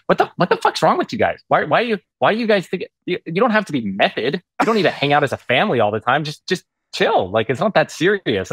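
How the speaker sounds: hammed-up incredulous director. Director scolding the actor for staying in character, mock exasperated. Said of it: "And he was like".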